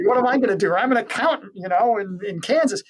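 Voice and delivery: high, loud, exasperated mock protest; protesting ordinary people. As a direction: play a flustered nobody objecting to a huge mission. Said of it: "And these people go".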